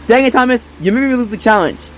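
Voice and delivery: normal talking voice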